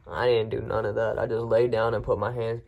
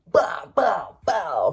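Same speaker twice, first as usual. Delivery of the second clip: mock gunshot noises; 7 semitones higher, 4 dB louder, much slower than usual. Mimicking gunshots he wanted to fire.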